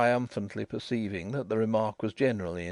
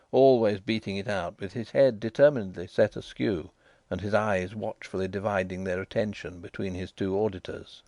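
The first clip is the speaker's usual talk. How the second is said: ordinary delivery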